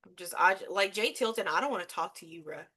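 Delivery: usual speaking voice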